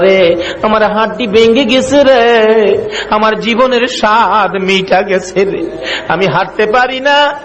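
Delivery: high, sung lament of an injured mother; acted wail of pain. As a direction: wail melodically, stretching each line end. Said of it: "ব্যথায় অস্থির চিল্লাইতেছে". (ব্যথায় সুর করে আর্তনাদের অভিনয়)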